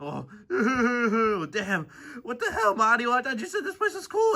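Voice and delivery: high, tearful protest; frightened complaint. Acted. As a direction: wail at your friend for bringing you. Scared character blames Mario.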